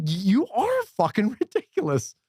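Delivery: high-pitched, slow, drawn-out mock amazement; hammed-up astonished agreement; retold incredulity. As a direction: stretch it out high in disbelief. Retelling his reply to star.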